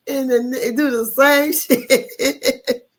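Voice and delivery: slow, laughing through the words; giggling disbelief at the repeat. Real, not acted. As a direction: drag it out while cracking up.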